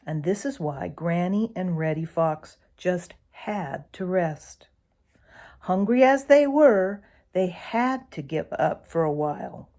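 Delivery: ordinary delivery, nothing marked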